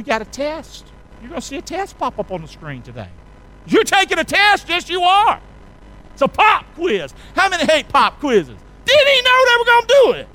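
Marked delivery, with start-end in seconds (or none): 3.7-10.3 high-pitched whiny student voice; mock student. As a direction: play a griping student dreading the pop quiz. Mock student whining about quiz.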